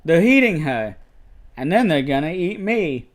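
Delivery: high, hammed-up horror-film victim; movie quote imitation. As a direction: mimic a badly acted cry of dread. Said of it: "saying it just like him".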